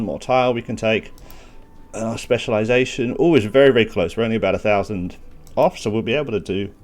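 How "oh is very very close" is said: usual speaking voice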